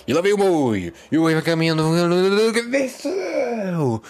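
loud, high country announcer building to a stretched cry; cattle-auction narrator. Acted. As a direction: hype up the cattle like a rural narrator. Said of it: "com voz de caipira". (narrador caipira de boiada, alto e empolgado)

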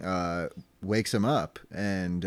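ordinary delivery

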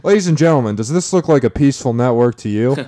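loud, grand courtroom plea; sway the jury with outrage; lawyer addressing the jury. Pretend. Mock courtroom closing argument.

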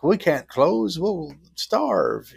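hammed-up small-town complaint; mock townsfolk whine. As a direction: whine like a panicked shopkeeper. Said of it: "most of them were like".

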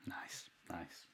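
breathy whispered praise. Real, not acted. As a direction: whisper it, still moved. Hushed reaction after hearing the poem.

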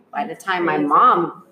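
ordinary talk

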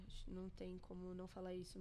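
quick whisper under the breath; whispered aside. Real, not acted.

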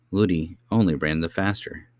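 plain reading voice

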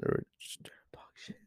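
low whisper, barely voiced; hushed, whispered jab; whispered aside. Real, not acted. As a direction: whisper the insult under your breath. Muttered insult aside about team.

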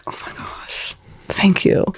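hushed, whispered retold thanks; awed whisper. Thanking grandparents for paying for school, retold in an awed whisper. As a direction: whisper it in grateful disbelief.

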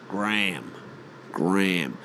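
GRAHAM as one-syllable "Gram"; mock Yank Gram for Graham; Graham rhyming with "ham"; American pronunciation. Shown as a demonstration. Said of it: "But the fucking Yanks".

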